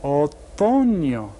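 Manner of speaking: very slow "Otoño", every syllable drawn out; slow teacher model; slow answer. Shown as a demonstration. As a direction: stretch "Otoño" so students hear each part. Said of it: "answer repeated slowly".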